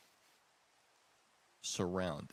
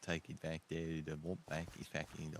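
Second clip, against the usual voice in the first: put-on Australian accent; 6 semitones lower than usual. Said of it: "speaking in an Australian accent".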